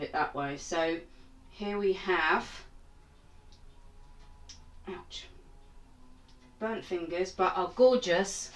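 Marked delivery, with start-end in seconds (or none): none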